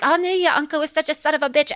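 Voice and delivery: nephew's wife badmouthing Scrooge; gossiping wife. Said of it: "she was like".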